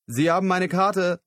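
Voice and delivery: laut und höher hinterhergerufen; nachgerufen. Acted. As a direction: ruf ihm laut hinterher. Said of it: "rief Christopher ihm nach". (Christopher ruft dem weggehenden Lyle laut hinterher)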